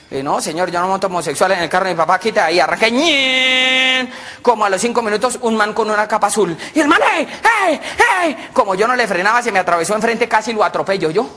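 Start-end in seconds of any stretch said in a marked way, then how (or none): 2.9-4.1 high, sustained engine whine; car take-off noise. Retold or imitated. Driver speeding off from hitchhiker, whining engine noise. Imitates car pulling away. 7.1-8.5 high, repeated hailing cries; calling out. Acted. Hitchhiker in the rain hailing a driver, calling out. Second man hails car.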